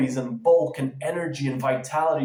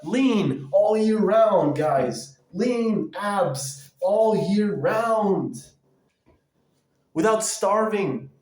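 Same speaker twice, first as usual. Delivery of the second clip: slow, higher, stretched-out fitness hype; pumped-up pitch; 4 semitones higher, 4 dB louder, much slower than usual. Acted. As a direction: sell it like an infomercial coach. Hyping lean body to viewers.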